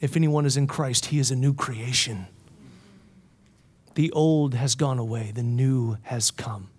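low, soft, reverent recitation; hushed scripture quote. Real, not acted. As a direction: let the verse settle softly.

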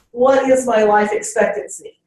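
usual speaking voice

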